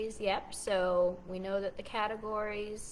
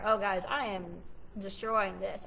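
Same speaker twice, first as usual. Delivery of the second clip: normal talking voice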